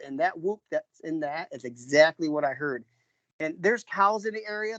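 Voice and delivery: ordinary talk, nothing marked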